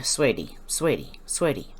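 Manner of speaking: low, soft, patronising coo; condescending sweetie. Pretend. Mocking the ranting speaker, soft and patronising. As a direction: talk down to them like a child. Mock-soothing the upset clip speaker.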